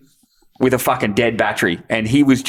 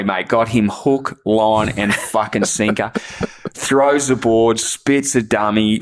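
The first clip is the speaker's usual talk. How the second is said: normal talking voice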